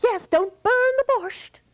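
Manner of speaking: put-on high, fast, boastful boy; a chatty youth brushing off the warning; Sasha. Sasha again.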